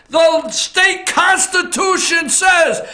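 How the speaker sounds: "constitution says" slowed and pushed hard; emphatic. Real, not acted. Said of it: "Put a little emphasis on it".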